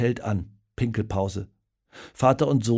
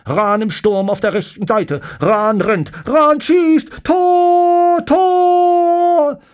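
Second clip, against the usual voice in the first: high reporter call climbing to screamed goal; tense commentary building; 11 dB louder, much slower than usual. A narrator's reading. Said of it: "ruft der Reporter angespannt".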